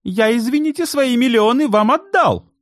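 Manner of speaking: high, loud indignant protest; outraged cry. A narrator's reading. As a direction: demand your millions back, outraged. Client outraged about lost millions. (возмущённый крик)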